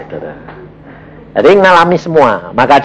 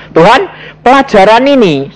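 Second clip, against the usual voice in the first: cheeky; 9 semitones higher than usual. Retold or imitated. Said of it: "nakalnya gini... saya bilang".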